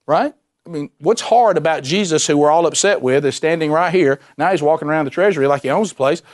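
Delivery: ordinary delivery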